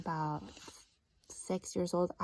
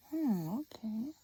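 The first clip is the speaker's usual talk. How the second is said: usual speaking voice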